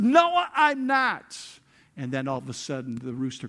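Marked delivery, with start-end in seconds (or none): 0.0-1.4 high, loud, slow cursed denial; angry denial. Retold or imitated. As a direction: bark it back with profanity behind it. Peter cursing and denying.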